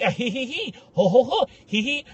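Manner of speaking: high, teasing hee-hee ho-ho giggle; mock mocking laugh. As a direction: imitate people laughing at you. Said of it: "and laugh and be like".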